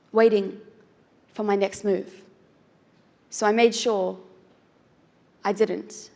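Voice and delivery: measured poetry recital with dramatic pauses between phrases; spoken word poem. Acted. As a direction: perform it as slam poetry, building in intensity, then holding back.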